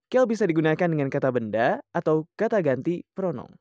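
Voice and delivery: normal talking voice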